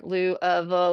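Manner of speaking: Lou, uh, Vul with uh for the middle; pronunciation variant demo. Shown as a demonstration. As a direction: say Lou, uh, Vul in three loose syllables. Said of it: "how do you pronounce it".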